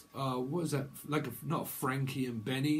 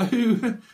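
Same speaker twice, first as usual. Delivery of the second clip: loud laugh; 7 semitones higher, 8 dB louder than usual. Real, not acted. Bursts out laughing at own joke.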